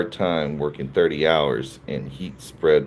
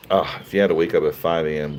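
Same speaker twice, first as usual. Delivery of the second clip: ordinary delivery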